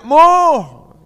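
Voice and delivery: loud, high holler; shouted call. Retold or imitated. Habib shouts to the father from the chicken coop, loud calling shout. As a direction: holler it out long, high-pitched. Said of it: "udah teriak".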